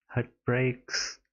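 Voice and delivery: normal talking voice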